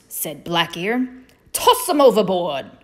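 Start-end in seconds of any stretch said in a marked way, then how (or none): 1.5-2.7 loud pirate captain's order; pirate yell. Acted. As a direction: call it out to the crew, a little raised. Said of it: "he yelled to the other bunnies".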